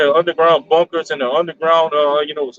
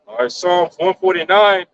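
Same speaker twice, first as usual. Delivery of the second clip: usual speaking voice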